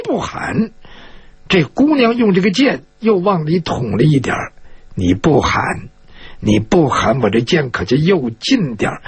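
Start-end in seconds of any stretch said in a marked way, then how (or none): 5.0-9.0 low-pitched, controlled menace; low threat. Acted. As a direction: threaten at sword point without raising your voice. Woman threatens captive with sword. (压低嗓音威胁)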